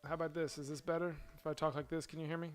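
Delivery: loud test read. Shown as a demonstration. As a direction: project it out for the listener. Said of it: "let me change that".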